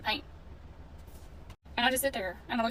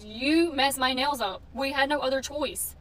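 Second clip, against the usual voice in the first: angry complaint; 3 semitones higher, 5 dB louder, slower than usual. Retold or imitated. Arguing with the nail tech.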